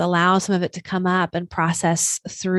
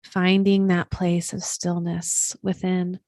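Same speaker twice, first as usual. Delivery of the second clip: guided meditation. Acted. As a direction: settle them into stillness, unhurried and even.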